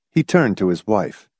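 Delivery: usual speaking voice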